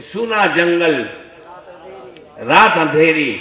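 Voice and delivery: loud, slowed recitation of the couplet; poem recital. Acted. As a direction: declaim the verse to the crowd. Recites Ala Hazrat's verse. (ज़ोर से शेर पढ़ना)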